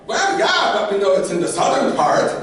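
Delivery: high, loud insistent retort; hammed-up stubborn protest; defensive comeback. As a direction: argue back at full volume. Acted defensive comeback in quarrel.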